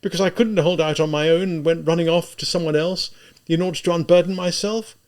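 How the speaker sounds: high, loud, exasperated pleading; anguished, insistent questioning; agitated outburst. A narrator's reading. Tormented outburst at weeping Sonia.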